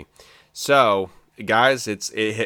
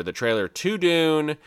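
higher, hyped-up playful repeats of Dune; excited about Dune; 7 semitones higher than usual. Real, not acted. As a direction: savour the word Dune like a fan who can't wait. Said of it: "Guys, it's Dune".

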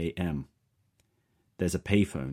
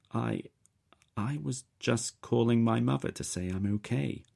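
stammering; 7 semitones higher than usual. A narrator's reading. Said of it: "I stammer".

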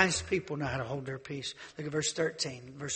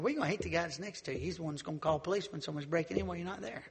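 fast aside; faster than usual. Real, not acted. Rapid aside.

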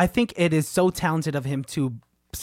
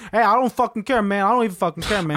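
mock don't-care outburst, pitched up a bit; swaggering, exaggerated impersonation of a friend; Otis; 3 semitones higher than usual. Host impersonates his friend to tease him, mock indifference. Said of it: "my best impersonation of Otis".

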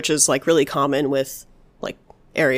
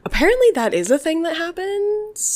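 high squeaky voice; high-pitched take; 13 semitones higher than usual. Pretend.

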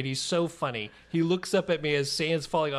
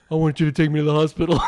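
loud, rapid-fire deadpan quote; son quoting PSA; 2 semitones lower, 8 dB louder, much faster than usual. Retold or imitated. Son quoting a PSA to dad from the sand, fast loud mock request. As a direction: rattle off the PSA line loudly. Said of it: "he looks up at me and says".

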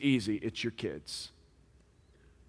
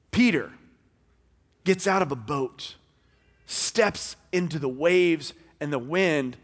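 usual speaking voice

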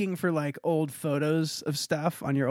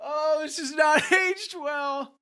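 laughing; 11 semitones higher, slower than usual. Real, not acted. Cringing at an old description.

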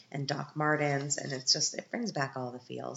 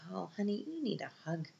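softer, higher, pitying coo; tender pity; 5 semitones higher, 6 dB quieter than usual. Retold or imitated. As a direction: coo it like comforting a sad teen. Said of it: "I was like".

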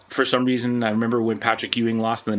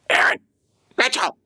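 much higher mock coach call; retold coach yell; 13 semitones higher, 4 dB louder than usual. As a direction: bark his name like an annoyed coach. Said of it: "he's just grilling me".

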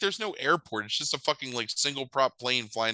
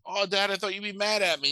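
higher put-on kid voice; imitated earnest son; 8 semitones higher than usual. Boy to his dad after race, mock earnest.

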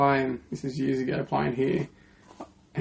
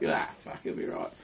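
mock muttered brush-off; 11 dB quieter than usual. Mate shrugging off his bag smash.

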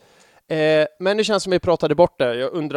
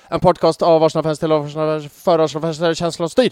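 as fast as possible. Shown as a demonstration. Host shows co-host his quick slogan, as fast as possible. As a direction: rattle the slogan off in one run. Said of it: "så snabbt som möjligt".

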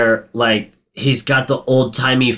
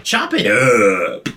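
plant monster voice; much slower than usual. Retold or imitated. Voicing the plant.

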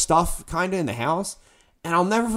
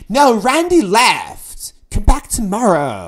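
mocking the store lady; 4 semitones higher, 7 dB louder, slower than usual. Store lady refuses to sell.

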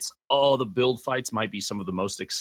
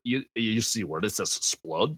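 put-on Russian accent. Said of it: "read the word in Russian accent".

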